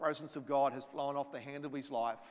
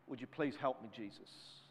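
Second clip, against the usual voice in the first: softer, breaking into a whisper on Jesus; hushed plea; 4 semitones lower, 9 dB quieter than usual. Retold or imitated. Man pleading with Jesus, soft whispered plea. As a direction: pray it under your breath. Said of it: "And he cries out".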